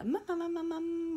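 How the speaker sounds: high sing-song "mm-mm-mm" while recalling; searching-for-the-next-bit hum; thinking hum. Real, not acted. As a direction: hum high to fill the gap. Filling time while recalling what's next.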